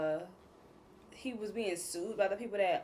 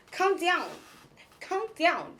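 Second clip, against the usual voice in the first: high, sing-song mock "come down"; hammed-up meme voice imitation; imitating the meme lady; 8 semitones higher, 4 dB louder, slower than usual. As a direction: copy that meme lady's coaxing call. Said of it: "That's what he sounded like".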